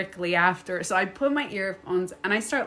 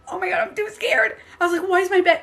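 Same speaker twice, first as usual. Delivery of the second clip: high, frightened oh my God; mock scared; 9 semitones higher, 4 dB louder, faster than usual. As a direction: panic at the shaking bed. Scared as bed shakes.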